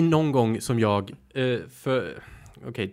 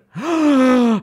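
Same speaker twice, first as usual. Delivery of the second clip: mock shocked gasp; 11 semitones higher, 7 dB louder than usual. Mock offense about best friend.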